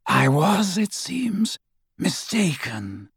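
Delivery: high villain voice fading to a whisper; soft, deliberate, lingering on the last word; Voldemort. A narrator's reading. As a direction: start high, then hush it slowly away. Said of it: "said Voldemort".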